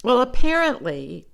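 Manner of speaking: usual speaking voice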